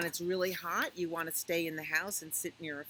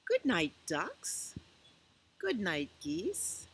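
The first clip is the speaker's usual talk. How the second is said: gentle, lilting picture-book read-aloud; reading to children; 5 semitones higher, 4 dB louder, slower than usual. A narrator's reading. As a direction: read it slowly to little ones at bedtime.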